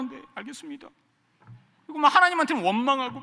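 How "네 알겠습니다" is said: meek compliance. Retold or imitated. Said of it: "밖에서는 화내지 못하니까".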